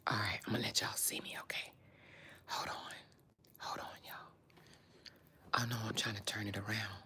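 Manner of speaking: low hushed voice, sneaking upstairs. Real, not acted. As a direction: keep it hushed, hiding the phone. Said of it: "I'ma hide the phone".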